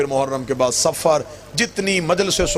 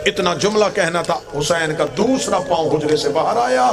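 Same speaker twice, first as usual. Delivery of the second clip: impassioned majlis recitation; 7 semitones higher than usual. Acted. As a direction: drive the climax of the masaib home. (ऊँची, जोशीली मजलिस की ख़िताबत)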